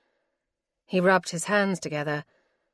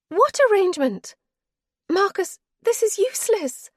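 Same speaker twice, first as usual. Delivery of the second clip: higher-voiced wife, cold and weary; wife's voice; 7 dB louder than usual. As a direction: she refuses him, tired and final. Wife's voice again.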